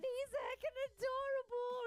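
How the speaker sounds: high squeaky gushing over a cute pet. Real, not acted. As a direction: coo it up high like praising a puppy. Gushing over balloon shark.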